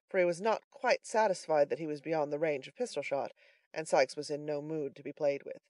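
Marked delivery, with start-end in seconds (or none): none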